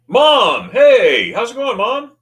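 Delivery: loud, high hello to mom; excited greeting. Real, not acted. Streamer spots mom in the chat, excited shout. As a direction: call out, drawing out the hey.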